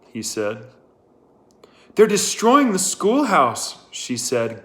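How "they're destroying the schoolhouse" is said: high and loud, breathless with alarm; gasping for breath. A narrator's reading. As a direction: burst in out of breath from running. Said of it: "gasping for breath".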